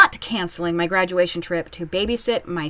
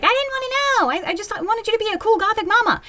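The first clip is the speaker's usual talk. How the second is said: much higher, fast whiny viewer; whiny viewer impression; 13 semitones higher than usual. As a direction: squeak it like a let-down fan. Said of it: "you're like".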